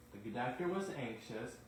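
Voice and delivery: ordinary delivery, nothing marked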